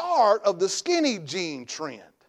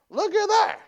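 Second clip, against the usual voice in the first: much higher, awed "look at that"; admiring mock voice; 12 semitones higher than usual. Preacher mimicking a shopper gushing over mannequins, awed.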